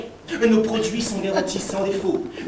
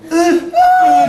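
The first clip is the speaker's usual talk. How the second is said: high cry; 10 dB louder than usual. Acted. Cri aigu du médecin.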